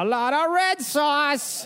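goofy high voice. Retold or imitated. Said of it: "he goes".

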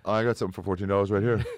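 usual speaking voice

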